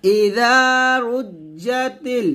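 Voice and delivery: রুজ্জাতিল recited melodically; recitation demo. Shown as a demonstration. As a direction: model the verse. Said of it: "আবার পাতলা করে বলবেন". (তিলাওয়াত করে দেখানো)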